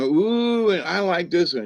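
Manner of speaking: put-on high, thrilled little-girl squeal; little girl's delight. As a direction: coo it like an excited four-year-old. Child delighted over amethyst.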